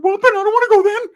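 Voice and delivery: put-on very high squeaky kid voice; high loud little-kid plea; kid Ricky. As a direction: squeak it out like an eager little boy. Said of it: "Ricky's like".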